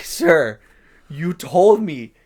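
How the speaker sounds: loud, slow, high-strained insistence; retold exasperation pushed to cracking point; barely keeping composure. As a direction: stretch each word, trying not to lose it. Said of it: "my voice is cracking because I'm trying not to lose it".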